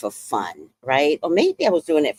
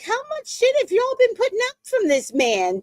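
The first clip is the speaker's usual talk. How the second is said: high, squeaky disbelieving question; incredulous; 4 dB quieter than usual. Retold or imitated. Asking the men how long they put up with him, high. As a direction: ask it in total disbelief. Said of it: "I'm like".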